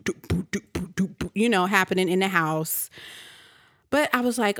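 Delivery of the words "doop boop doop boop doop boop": soft beeping noise. Retold or imitated. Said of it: "I did hear the little boop".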